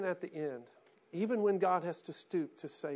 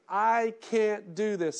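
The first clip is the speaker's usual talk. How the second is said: slow loud cry; 5 semitones higher, 8 dB louder, much slower than usual. Retold or imitated. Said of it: "once you realize".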